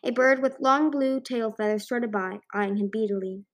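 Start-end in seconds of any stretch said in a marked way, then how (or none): none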